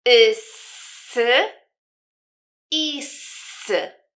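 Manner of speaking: "Asa, oso, usu, esse, ysy, issy" with a long drawn-out s in the middle; slow, separated drill of "Asa, oso, usu, esse, ysy, issy" with sustained middle s; syllable demo. Shown as a demonstration. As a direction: stretch the first vowel and the "s" in each, pausing between items. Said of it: "jakie są to sylaby".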